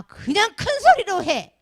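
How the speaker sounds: loud, high, drawn-out mock order; acted-out bellowed command; imitated yelling. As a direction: shout it like an impatient choir leader. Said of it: "큰 소리를 하라 되는데".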